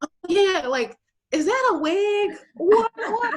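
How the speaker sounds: high, loud squeal of nosy amazement; mock astonished coworker. Mocking coworkers gawking at a wig.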